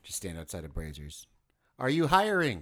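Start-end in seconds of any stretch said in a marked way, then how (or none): 1.8-2.6 put-on Canadian accent. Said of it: "So was that your Canadian accent?".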